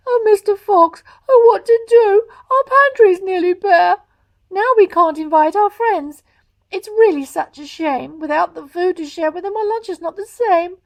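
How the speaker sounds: high, loud tearful fox wife; crying Missus Fox. Acted. Missus Fox lamenting the bare pantry to her husband, tearful. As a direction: wail it out in distress. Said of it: "crying hard".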